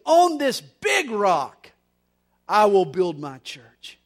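slow and loud, hammering it home; big emphatic sermon declaration; loud restated proclamation. Acted. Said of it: "In other words".

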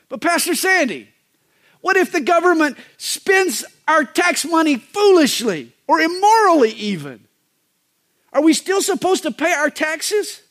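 high, pleading voice of a doubtful churchgoer; questioning congregant. Acted. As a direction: raise the pitch and ask like a worried member of the flock. Congregant's objection voiced by pastor.

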